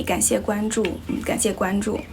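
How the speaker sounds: normal talking voice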